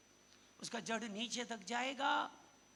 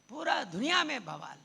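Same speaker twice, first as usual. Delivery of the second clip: 1 preaching shout; 9 dB louder than usual. Real, not acted. Preacher proclaiming to congregation.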